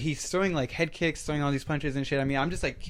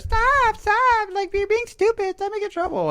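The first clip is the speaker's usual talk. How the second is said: very high falsetto scolding; squeaky imitation of a girl pleading; mimicked girl; 5 dB louder than usual. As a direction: put on a high girl's voice, loud at first. Voices girl breaking up fight.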